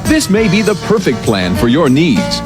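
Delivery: normal talking voice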